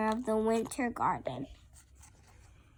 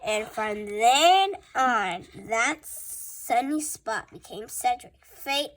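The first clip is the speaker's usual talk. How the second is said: plain reading voice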